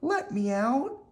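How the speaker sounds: put-on high, small puppet-boy cry; Pinocchio calling for help. Pinocchio trapped in the birdcage, calling high for help. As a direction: call out from the cage, high. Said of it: "called Pinocchio".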